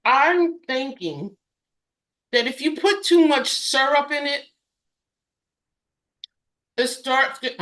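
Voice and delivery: talking with food in mouth; mouth full. Real, not acted. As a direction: speak around a mouthful. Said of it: "trying to talk with stuff in my mouth".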